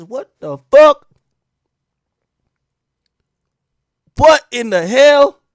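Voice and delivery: slow, high, loud disbelief; incredulous. Retold or imitated. Cops reacting to the doctor's wording, loudly incredulous. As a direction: stretch it out in loud mock astonishment.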